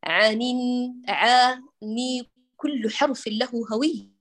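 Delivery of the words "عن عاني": عن drawn to عاني very slowly; slow articulation. Shown as a demonstration. Teacher shows letter-by-letter reading. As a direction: stretch عن, each letter distinct. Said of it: "يعني لما أقرأ لك".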